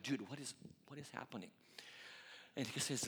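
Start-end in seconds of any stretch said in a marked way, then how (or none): 0.0-1.5 hushed aside. Retold or imitated. Asking friend quietly at the gym.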